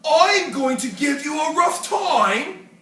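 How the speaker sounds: loud, threatening bully voice; menacing rhinoceros. Acted. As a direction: loom over the little one. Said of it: "said the rhinoceros".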